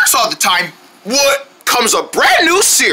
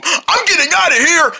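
ordinary delivery

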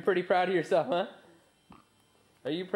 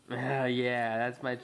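ordinary talk, nothing marked

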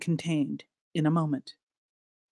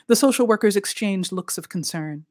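usual speaking voice

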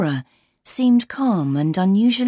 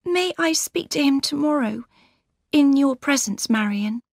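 put-on higher, gentle young woman's voice; Laura's voice; 8 semitones higher than usual. A narrator's reading. Laura greeting her sister warmly, higher voice. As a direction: lift into the young bride's voice.

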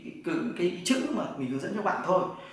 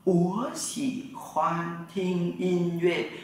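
even slower, each syllable drawn out; very slow Mandarin repeat; much slower than usual. Shown as a demonstration. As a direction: stretch every syllable for students to copy. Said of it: "Tôi thích nghe nhạc".

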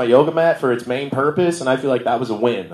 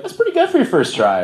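mock chirpy yoga teacher; 11 semitones higher than usual. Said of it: "They're like".

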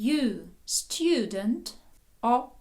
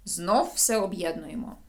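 ordinary delivery

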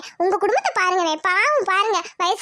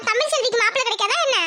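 normal talking voice

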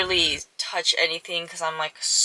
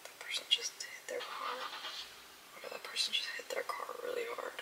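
hushed whisper, much softer; real whisper; 5 semitones higher, 19 dB quieter than usual. Real, not acted. Watching a crash outside, stunned hushed whisper. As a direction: whisper so the street won't hear. Watching a car hit nearby.

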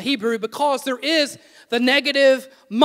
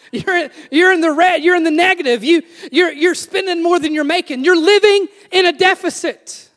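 higher, louder, piling up the charges; loud mock telling-off; 5 semitones higher, 6 dB louder than usual. Preacher answers his own question to AJ, loud mock scolding. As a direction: hammer each phrase, loud and high. Teasing answer, apologised for afterwards.

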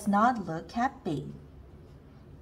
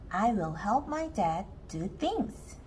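usual speaking voice

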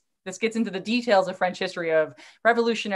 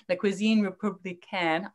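French pronunciation of the title; French accent. Shown as a demonstration. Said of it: "I so apologize for my French accent".

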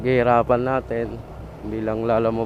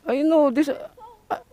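distressed plea; 13 semitones higher, slower than usual. Real, not acted. Desperate plea, cry heard.

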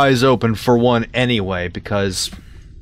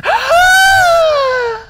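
long, loud, high gasp; huge excited gasp; 7 dB louder than usual. Real, not acted. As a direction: gasp loudly at a great find. Finds the audio tape.

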